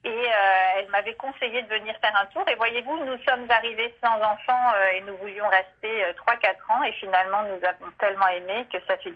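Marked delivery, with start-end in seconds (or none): none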